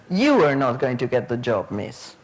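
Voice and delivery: low-pitched comeback with stress on "You", falling away; kid's retort. Retold or imitated. Pupil answering back to the trainee teacher, low retort stressing "You". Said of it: "And the kid answers".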